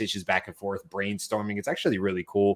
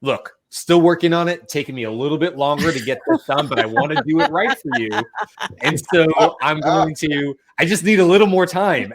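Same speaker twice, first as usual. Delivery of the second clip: upbeat chatbot reply; 5 semitones higher, 5 dB louder than usual. Retold or imitated. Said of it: "speaking in a, in an English accent".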